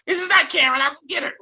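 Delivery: very high, loud squeaky protest; sister-like young voice. Retold or imitated. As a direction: imitate your unbroken voice, squealing indignantly. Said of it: "I sounded like my sister".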